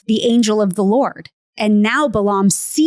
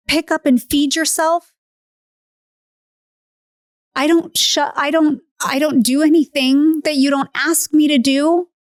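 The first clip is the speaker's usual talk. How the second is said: high, emotional appeal; wounded, earnest questioning; hurt body pleading; 5 semitones higher than usual. Retold or imitated. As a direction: plead as the faithful one wronged. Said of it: "my body said back to me".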